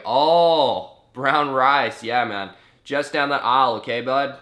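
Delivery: put-on loud, chummy Canadian accent; hammed-up Canadian accent of dawning understanding; Canadian shop worker. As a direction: imitate a Canadian who suddenly gets it. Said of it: "translate my accent from Australian to Canadian".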